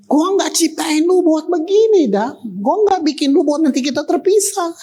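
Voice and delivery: God speaking high, pleading to Adam. Acted. God pleading with Adam not to be separated, high and earnest. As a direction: plead like a heartbroken father.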